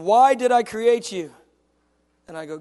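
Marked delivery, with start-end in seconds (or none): 0.0-1.3 loud, high and slow; God's booming question. Retold or imitated. God asking his son a question, loud and slow. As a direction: boom the question out, stretched and emphatic. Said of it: "he says".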